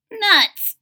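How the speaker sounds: high, loud frustrated cry; little witch exclaiming. Acted. Maggie grumbles that nobody notices, high and loud. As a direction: spit it out, fed up. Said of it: "said Maggie".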